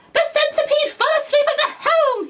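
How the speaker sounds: high, loud furious bark; barking grasshopper. A narrator's reading. Grasshopper blaming the centipede, loud furious bark. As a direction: bark it in fury. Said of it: "he barked".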